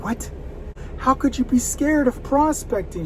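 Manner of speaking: high, slow, disbelieving question; incredulous. Retold or imitated. Coach asking a scared new agent, incredulous and drawn out. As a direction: stretch it out in disbelief at her fear.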